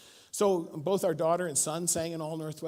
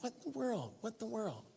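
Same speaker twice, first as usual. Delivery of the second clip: soft, higher puzzled murmur; baffled mutter; 4 semitones higher, 11 dB quieter than usual. Retold or imitated. Young reader puzzling over the text, soft and baffled. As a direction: half-whisper your confusion to yourself. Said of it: "I'm like".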